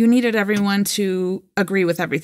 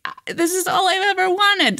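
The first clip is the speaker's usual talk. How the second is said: mock despair; 11 semitones higher than usual. Retold own devastated reaction.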